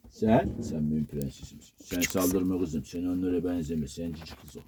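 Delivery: low, soft, fond words to a pet; tender cooing. Real, not acted. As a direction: coo to the little one, low and soft. Cooing to his favourite pup.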